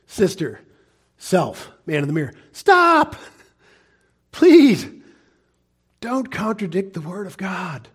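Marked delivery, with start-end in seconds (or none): 2.5-7.9 an urgent raised please; pleading. Acted. Pleading with the listener.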